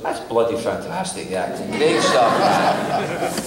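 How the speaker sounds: laughing Scottish father, beaming; delighted Scottish dad. Retold or imitated. Father praising son's acting gig, laughing Scottish accent. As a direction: chuckle through the praise, thick Scottish.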